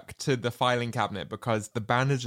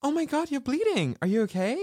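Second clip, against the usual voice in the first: mock concern; faster than usual. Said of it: "she's like".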